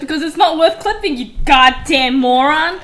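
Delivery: loud rant, dragging out the insult; heated, emphatic put-down; exasperated scolding. Real, not acted. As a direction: tear into a friend's silly request. Scolding friend asking for a clip.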